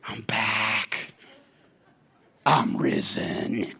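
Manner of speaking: a slow hint of hero boast; mock-triumphant, slowly; lightly voiced superhero. Pastor plays a superhero returning, lightly boasting.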